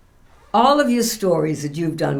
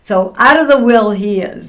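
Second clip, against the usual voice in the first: laughing punchline; 3 semitones higher, 7 dB louder than usual. Real, not acted. Punchline about son.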